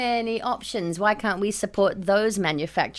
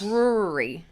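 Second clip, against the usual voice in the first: Aussie brewery; slower than usual. Real, not acted. Australian accent, repeating "Brewery" for friends. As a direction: say "Brewery" the Aussie way, barely any R. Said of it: "you have an Aussie accent".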